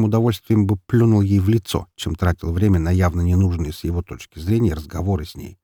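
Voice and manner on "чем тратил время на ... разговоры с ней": plain reading voice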